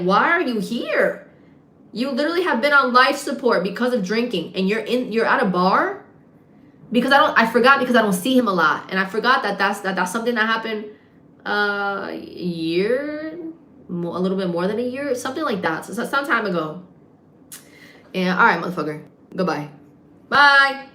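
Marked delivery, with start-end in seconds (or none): 0.0-6.0 heated, higher-pitched scolding; passionate, pissed off. Retold or imitated. Bartender scolds cousin back from life support, pissed off. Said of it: "passionate about that. He pissed". 20.3-20.9 louder, higher send-off; loud goodbye. Real, not acted. Storyteller breaks off to send someone away, loud goodbye.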